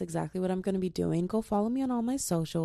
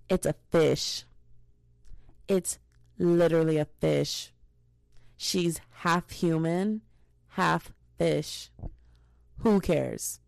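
exasperated; 3 dB louder, slower than usual. Real, not acted. Rant against racist comments.

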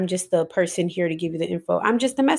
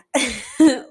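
surprised laugh at herself; burst of laughter; 6 semitones higher, 4 dB louder than usual. Real, not acted. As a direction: laugh out loud at the slip. Laughs at own stumble.

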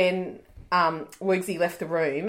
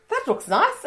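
high, mild imitation of mum; a light high mum voice; mum's voice; 14 semitones higher than usual. Imitating her mum admiring the cake, lightly high. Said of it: "Mum came downstairs and was just like".

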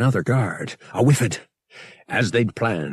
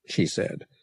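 ordinary delivery, nothing marked

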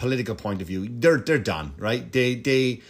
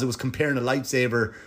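normal talking voice

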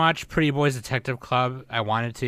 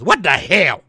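put-on loud, high Vietnamese accent; joke-yell like the Vietnamese coworker; 5 semitones higher, 8 dB louder, slower than usual. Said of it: "sound like that Vietnamese coworker".

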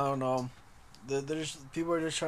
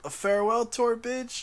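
higher, louder repeat; exasperated repeat; 7 semitones higher, 4 dB louder than usual. Real, not acted. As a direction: spell it out, fed up. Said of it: "repeating answer emphatically".

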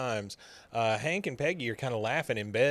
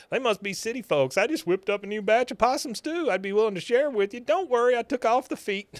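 much higher, hammed-up hillbilly voice; [name] playing hillbilly; 10 semitones higher than usual. Friend offering possum stew to scare buyers, hillbilly act. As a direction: play the folksy hillbilly, pitched high. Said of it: "doesn't have any problem playing this part".

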